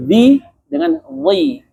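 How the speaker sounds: "Zi" thin, then "zi" thick, slow; teacher contrasting sounds. Shown as a demonstration. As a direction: contrast the two "zi" slowly. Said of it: "beda kan?".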